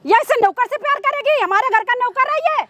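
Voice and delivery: outraged mother's tirade; scornful, indignant scolding; angry scolding. Acted. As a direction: berate him with indignant questions. Acted mother berates daughter.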